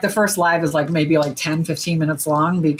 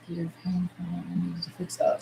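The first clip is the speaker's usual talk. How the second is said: reading under breath; 12 dB quieter than usual. Real, not acted. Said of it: "Sorry, I'm just reading".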